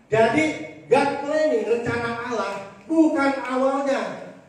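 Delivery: loud, driving sermon cadence; forceful preaching. Real, not acted. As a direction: hammer each line home to the congregation.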